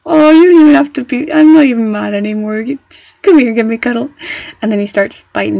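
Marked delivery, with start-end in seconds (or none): 0.0-4.1 puppy talk, melting; cooing at the puppy. Retold or imitated. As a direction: melt and coo at a cute puppy. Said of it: "you're like".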